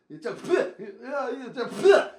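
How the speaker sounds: playful spitting noise with a laugh; mock spit sound. Kid on the bus spitting, mock spit noise.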